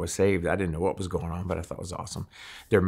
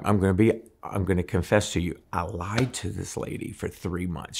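ordinary talk

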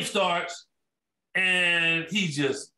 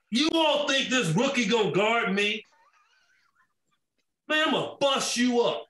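acted-out taunt, pitched up; mock trash talk; 4 semitones higher than usual. Bird taunting the coach about his rookie, cocky challenge. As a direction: call out the bench with a cocky challenge.

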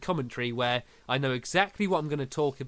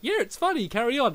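amused; 9 semitones higher than usual. Retold or imitated. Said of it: "I was like".

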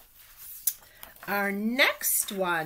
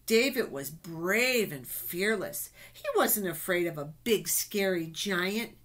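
ordinary delivery, nothing marked

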